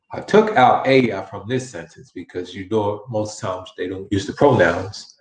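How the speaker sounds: ordinary talk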